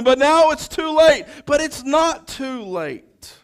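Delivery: loud, high, ringing proclamation; preaching at full volume, building to a call; full-force preaching. Acted. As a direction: proclaim it to the whole congregation. Rising to a preaching climax.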